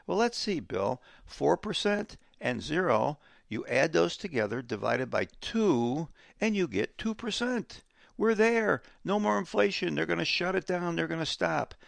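high mock voice of a gullible investor; sarcastic impression of the average investor; mocking naive investors. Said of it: "everybody looks and says".

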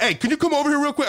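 high-pitched call to come over; calling someone over. Retold or imitated. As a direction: catch someone's attention from across a hallway. Waving the security guard over.

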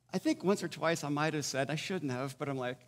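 ordinary talk

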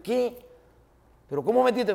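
dad can't believe his ears; mock shocked father. Angry father voiced in story.

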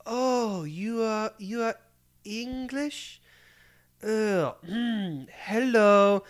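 high, slow, patronizing English; lightly voiced haughty woman talking down; mock condescending Frenchwoman. Imitating a French woman switching to English for a tourist, mock condescension. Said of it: "she was like".

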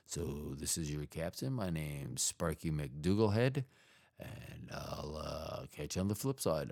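low, quiet pilot announcement; deep captain cabin announcement; airline pilot voice. Pretend. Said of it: "I sounded kinda like an airline, uh, pilot".

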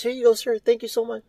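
sweet customer-service voice; polite cashier voice. Retold or imitated.

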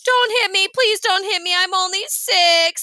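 put-on high, loud childish wail, last words drawn out; crying plea. A narrator's reading. Daniel begs Elaine not to hit him, acted crying. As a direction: plead tearfully like a scared little kid. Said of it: "Daniel started to cry".

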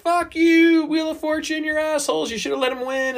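mocking outraged Twitter. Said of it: "Twitter was like".

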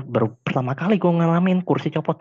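ordinary talk

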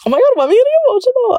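very high, rushed mock choking-up; pretend emotional. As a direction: squeak it out as if jokingly about to cry. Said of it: "I'm just kidding".

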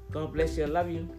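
normal talking voice

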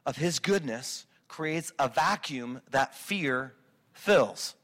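slow, weighted statement. Shown as a demonstration. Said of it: "A lack of our knowledge".

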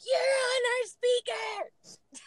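high-pitched retold yell; screaming. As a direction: imitate an angry crowd yelling at you. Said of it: "They were all screaming at me like".

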